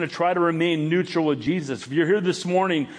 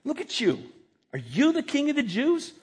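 higher voice, "you" lifted in mock disbelief; sarcastic stress on YOU; 9 semitones higher than usual. Shown as a demonstration. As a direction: aim "you" at him as a high, scornful question. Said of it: "The emphasis on the word "you".

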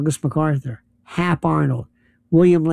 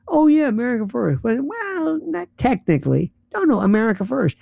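higher-pitched mock hedging; mocking imitation; 6 semitones higher than usual. Imitating people who defend America First, mockingly. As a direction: imitate their squirming excuses in a higher voice.

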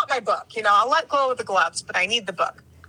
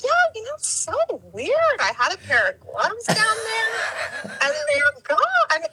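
higher, slower, pointed hint about the gloves; pointed repeated hint; 11 semitones higher, slower than usual. Retold or imitated. To the glove-taking jogger, a slower, higher pointed hint. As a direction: drag it out so he finally gets the hint. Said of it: "I'm gonna do it again".

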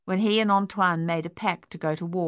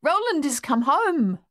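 high, excited exclamation; joyful cry; 9 semitones higher, 3 dB louder than usual. A narrator's reading. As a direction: cry out the homecoming at a high pitch. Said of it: "Madame Chessy cried".

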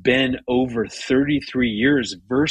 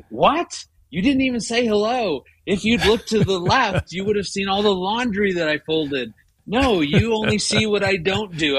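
higher, wounded and indignant; indignant inner rant; 8 semitones higher than usual. Retold or imitated. Husband silently fuming at wife, higher indignant rant. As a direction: let the hurt pride run, higher pitched. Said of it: "ramping up in my mind".

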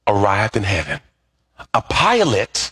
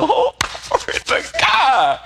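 high, drawn-out acted rejoicing; hammed-up delight with a laugh; overjoyed; slower than usual. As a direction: whoop it up, slow and high. Preacher delighted at heavenly house.

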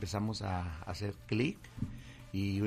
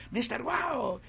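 fans calling out; 13 semitones higher, slower than usual. Retold or imitated. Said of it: "me empecé a encontrar a la gente".

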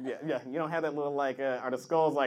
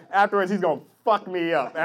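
ordinary delivery

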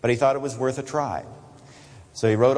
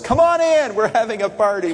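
welcoming father; 9 semitones higher, 4 dB louder than usual. Acted. Father inviting son to party.

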